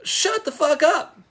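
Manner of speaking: high, slow, fed-up put-down; exasperated retort. Retold or imitated. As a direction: drag it out, fed up with the whining. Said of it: "And I'm like".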